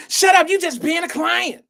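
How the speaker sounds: high, loud mock yell; getting all loud. Girl answering a whispered plea, suddenly loud and high. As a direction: blast a girl's dismissal at high pitch. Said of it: "She trying to get all loud".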